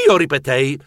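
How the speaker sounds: ordinary delivery, nothing marked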